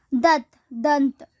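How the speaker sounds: दत्त then दंत with a clear nasal n, said slowly; wrong then right. Shown as a demonstration. Teacher models adding anusvara, slow and deliberate. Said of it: "यथास्थान अनुस्वार या चंद्र बिंदु लगाएं".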